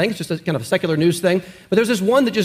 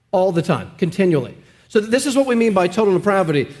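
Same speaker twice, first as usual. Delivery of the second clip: normal talking voice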